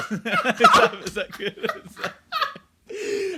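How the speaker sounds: breaking into laughter; laughing. Real, not acted. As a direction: laugh through the question. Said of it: "not comedy necromancer".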